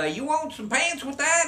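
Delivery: high, lilting little salesman asking; little clerk voice. Acted. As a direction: pitch it way up like the tiny clerk.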